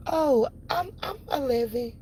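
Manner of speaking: put-on child voice. Imitating the kid at counter.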